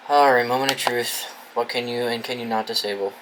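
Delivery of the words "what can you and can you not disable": ordinary delivery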